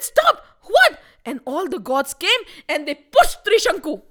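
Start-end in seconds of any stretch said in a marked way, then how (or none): 0.0-1.0 high, loud indignation; outraged command. A narrator's reading. Indra rebuffs Trishanku at his throne, outraged command. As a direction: halt him with a shocked outburst.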